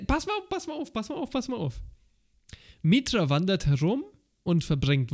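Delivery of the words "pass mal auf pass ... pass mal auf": high, rushed excited "pass mal auf"; excited squeal. Pretend. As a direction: hype it up, voice shooting high. Excited high repeated call.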